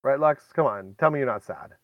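ordinary talk

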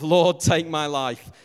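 loud, slow, hammed-up plea to die; mock groaning prayer. Newlywed husband sick with food poisoning, loud drawn-out mock prayer. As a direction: moan it like a man sick from bad chicken. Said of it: "the genuine prayer of".

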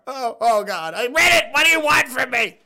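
high-pitched, anguished outburst; exasperated protest. Pretend. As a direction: cry out as if tormented by the task. Forced to read an embarrassing message.

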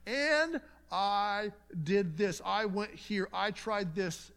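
high, loud and very slow, drawn out like a proud person listing deeds; mock self-reliant boast. Preacher mimics a self-reliant believer's answer, slow and boastful. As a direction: stretch out each boast in a put-on voice.

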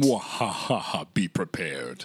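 low, slow villain cackle; evil villain voice. Pretend. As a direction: gloat like a cartoon villain. Villain laugh and line.

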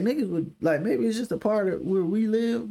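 normal talking voice

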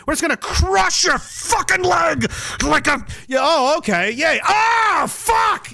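high shouted ouch; hammed-up yell of pain; mock pained yell. Medics then pained yell.

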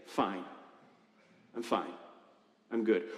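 low, soft, clipped "I'm fine"; quiet dismissive fine; curt brush-off. Retold or imitated. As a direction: mumble it off to end the talk. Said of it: "We, we've learned to just say".

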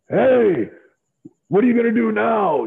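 loud, high call across the cafeteria; boss yelling. Retold or imitated. The boss yells at the rookie across the cafeteria, loud and high. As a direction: yell it out like the boss spotting you. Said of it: "then Vince yells at me".

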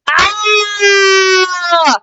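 long, high, loud scream; scream of pain. Acted. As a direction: scream out in acted agony. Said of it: "I started screaming in pain".

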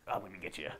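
"I'm gonna get you" muttered low and soft; soft mock taunt. Fan imitating Geralt's quick stance, a soft taunt. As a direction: play Geralt quietly sizing up his foe. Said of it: "he would just do".

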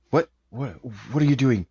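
high, alarmed pleading; panicked captive demanding answers; scared questions. Acted. Player voices scared character.

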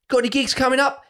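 high, eager fan question; mock eager fan. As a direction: pitch it up like an overkeen drunk. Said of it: "the one that fucking does me".

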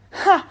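loud, high bitter bark of a laugh; sarcastic laugh. Acted. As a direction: scoff out loud at the slogan. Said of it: "lachte Akos sarcastisch".

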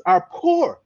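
"poor" lifted high and held; emphatic stress on "poor". Real, not acted. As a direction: raise the pitch and lean on "poor". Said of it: "let me put emphasis on this".

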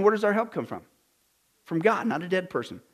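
ordinary delivery

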